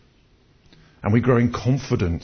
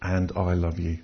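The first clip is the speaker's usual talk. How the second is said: gentle divine message; 7 semitones lower, 6 dB quieter than usual. Retold or imitated. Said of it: "And God is saying".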